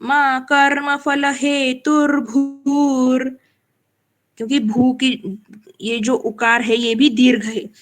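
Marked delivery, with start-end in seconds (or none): 0.0-3.3 very slow, with भू in हेतुर्भूर् drawn out; long भू held. Shown as a demonstration. Teacher shows the long ऊ in हेतुर्भूर्. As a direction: stretch the भू of हेतुर्भूर्. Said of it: "तो कैसे बोलना है?".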